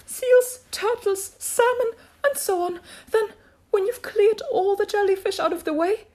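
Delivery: very high, loud creature voice; high turtle voice calling out steps; excited Mock Turtle. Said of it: "cried the Mock Turtle".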